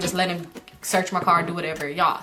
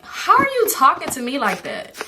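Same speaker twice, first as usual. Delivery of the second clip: indignant comeback; 6 semitones higher than usual. Retold or imitated. Acted comeback to crying girl's demand.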